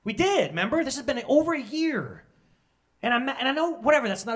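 high-pitched, worked-up indignation; a little angry. Real, not acted. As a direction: vent mild anger, pitch jumping up. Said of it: "I'm angry a little bit".

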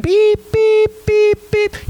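high, even beeps like an oven timer; timer beeping. Retold or imitated. Oven clock runs out at playtime, imitated high beeps. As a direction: imitate a kitchen timer going off. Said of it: "it starts beeping".

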